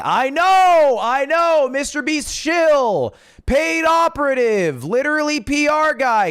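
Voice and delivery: high, loud chant of accusations; exasperated mock accusation. Heads off viewers' accusations of shilling.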